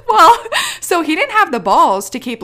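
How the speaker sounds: loud, high, laughing as you talk. Real, not acted. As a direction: burst back into the story through laughter. Cracks up telling her story.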